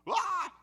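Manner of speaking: short loud high mock sobbing wail; a child's sudden mock wail; imitated sob. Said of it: "The sobbing went from this".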